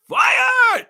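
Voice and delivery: huge, high one-word shout; shouted verdict. Real, not acted. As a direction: explode with the word. Fan demands coordinator's firing.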